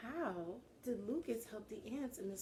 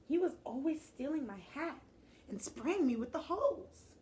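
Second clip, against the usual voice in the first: indignant outburst; 6 semitones higher, 7 dB louder than usual. Acted. Lucas recalls the bully.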